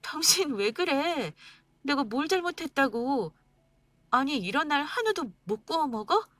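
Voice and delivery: wife protesting husband's anger, hurt. Acted. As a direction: defend yourself, hurt and confused. Wife hurt, defending herself.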